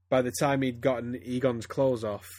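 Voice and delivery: ordinary delivery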